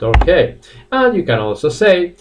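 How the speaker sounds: ordinary talk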